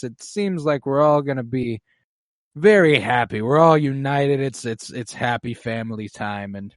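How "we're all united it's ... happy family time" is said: ordinary talk, nothing marked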